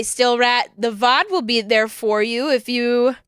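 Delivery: ordinary talk, nothing marked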